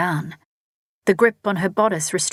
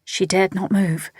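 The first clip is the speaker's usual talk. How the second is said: usual speaking voice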